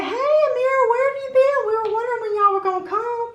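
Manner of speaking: imitated neighbors calling out, very high-pitched; excited party greeting. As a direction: gush a high, loud mock welcome.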